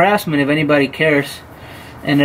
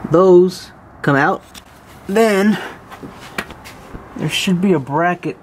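normal talking voice

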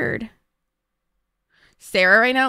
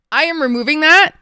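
stressed outburst; 6 semitones higher, 9 dB louder than usual. Real, not acted. Stressed streamer announcing fix.

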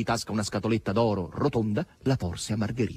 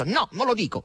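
dry, higher-pitched refusal; curt refusal; 12 semitones higher than usual. A narrator's reading. Asasello refuses to tell Margherita, curt and dry. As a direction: refuse curtly. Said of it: "rispose asciutto Asasello".

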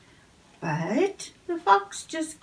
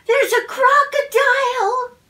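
much higher, louder cry of alarm; alarmed shout; 13 semitones higher, 7 dB louder than usual. A narrator's reading. Goose warning the fox about a crocodile, alarmed shout. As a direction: yell the warning with all you've got.

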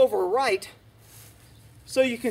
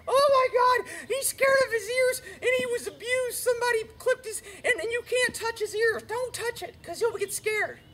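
mocking fussy owner; 11 semitones higher than usual. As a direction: sneer at the owner who coddles.

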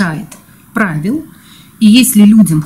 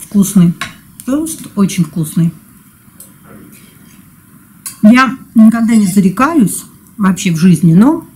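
talking while chewing; speaking between bites; mouth full of food. Real, not acted. Seen 0: fork to mouth at -4; pursed, moving lips while looking down.

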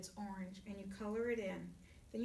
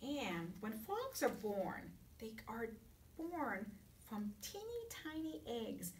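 whispering; 5 dB quieter than usual. Acted. Teacher whispers mid-sentence to kids.

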